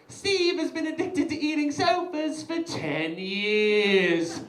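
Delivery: high, drawn-out narrator hook; enticing voice. Retold or imitated. Video narrator hooking viewers on Steve's addiction, enticing voice. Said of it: "an enticing voice".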